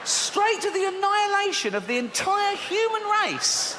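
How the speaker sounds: high, affronted complaint; indignant protest. Real, not acted. Host ranting at God's genocide, high and indignant. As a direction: sound scandalised at the overreaction. Mock outrage at God's flood.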